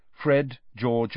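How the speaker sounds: ordinary delivery, nothing marked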